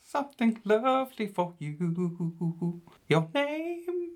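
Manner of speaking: very high, slow, crooning falsetto; [name] Neville. Acted. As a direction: croon every word like a soul singer. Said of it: "my name is [name]".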